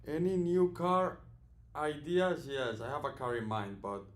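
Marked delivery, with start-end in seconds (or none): 0.1-2.5 high, slow, loud chat voice; reading chat question. Acted. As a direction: read it like a viewer's question. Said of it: "reads viewer question".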